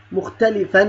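"مختلفا" ending in -an; "مختلفا" pronounced mukhtalifan with a clear final n; tanween fath. Shown as a demonstration. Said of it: "تنوين فتح".

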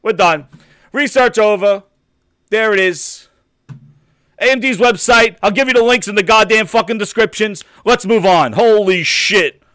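loud, high, heated ranting. Real, not acted. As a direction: keep yelling the rant. Ranting about console research.

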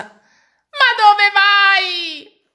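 very slow, high, loud plea; anguished cry. A narrator's reading. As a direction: wail after the nose. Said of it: "gridò il signore". (supplica straziante, lentissima, acuta e forte)

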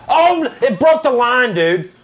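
usual speaking voice

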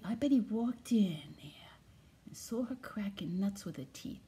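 hushed, secret-sharing whisper. Acted. Telling viewers a family secret, whispered. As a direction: lean in and whisper the juicy part. Conspiratorial gossip about grandpa.

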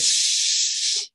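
long, loud hissed shush; urgent shush. A narrator's reading. Mole silencing the screaming turtle, long loud shush. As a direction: shush hard and hold it.

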